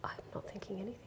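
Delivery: breathy hush of an empty mind; whispered thought. Retold or imitated. Telling a friend about meditating, whispered thought. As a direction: whisper it as if not to break the stillness.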